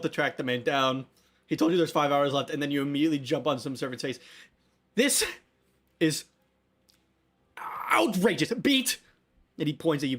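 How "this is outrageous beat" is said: higher, theatrical outburst with long dramatic pauses between words; outraged Yulin. Acted. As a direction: draw out the indignation, then call out the name high. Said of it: "Yulin's gonna be like".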